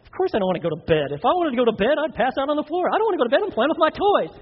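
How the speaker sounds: put-on high, quick, indignant kid; protesting child. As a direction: argue back like an outraged child. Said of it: "they're, like, looking at you like".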